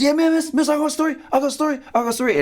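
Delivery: high, eager schoolboy rushing his words; excited kid begging the teacher to pick him; Little Johnny. Acted. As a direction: pipe up urgently from the back of class. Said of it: "little Johnny in the back".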